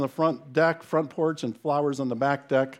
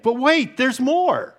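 big hammed-up TV pitchman, higher and louder; infomercial; 10 semitones higher, 7 dB louder, slower than usual. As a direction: sell it like a late-night TV ad. Classic infomercial catchphrase, hammed.

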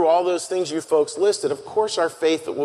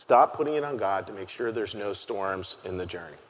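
quiet repetition; 7 semitones lower, 7 dB quieter than usual. Real, not acted. Repeated line, low and soft.

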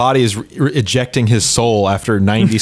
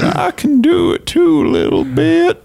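high, slow quavering Jimmy Stewart; old-timey drawl, pitched way up; Jimmy Stewart impression; slower than usual. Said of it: "Jimmy Stewart impersonation".

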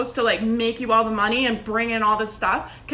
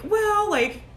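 higher-pitched, hesitant mock pitch; tentative sales pitch; 7 semitones higher than usual. Coach mocking a timid on-camera invitation to join, tentative. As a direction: imitate someone unsure of their own offer. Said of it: "I'm not going on camera being like".

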